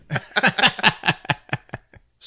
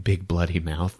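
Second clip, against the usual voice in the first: usual speaking voice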